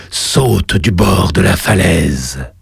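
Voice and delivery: deep, slow cavernous command; underworld god. Acted. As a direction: order the jump like a god. The lava god voice.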